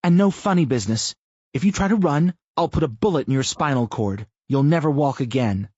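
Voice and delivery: cold threat. A narrator's reading. Tina threatens her prisoner.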